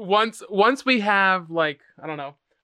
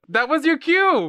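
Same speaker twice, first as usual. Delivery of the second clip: excited exclamation; 10 semitones higher than usual. Real, not acted. Teasing exclamation.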